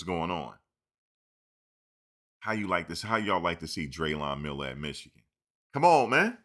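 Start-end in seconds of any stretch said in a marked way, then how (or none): 5.7-6.4 loud, high exasperated protest; exasperated plea. Real, not acted. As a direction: protest loudly, voice jumping up.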